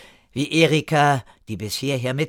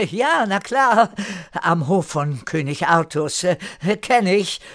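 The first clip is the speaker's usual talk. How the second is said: joking; 7 semitones higher, 4 dB louder than usual. A narrator's reading. Newcomer pretends to know King Arthur's court, joking. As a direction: play along lightly, with a chuckle first. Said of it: "scherzte er".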